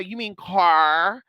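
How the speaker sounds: "car" said slowly with a full R; mock correcting. Mocking herself correcting a Louisiana speaker: slow "car". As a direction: drag out the correction "You mean car?". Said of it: "trying to correct somebody".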